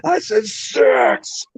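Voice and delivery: high mock yell without full volume; retold scream. Re-enacting what he screamed in there, mock yell. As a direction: imitate yourself screaming an order, reined in. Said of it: "I mean, I screamed it in there".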